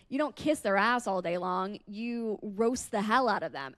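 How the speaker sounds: usual speaking voice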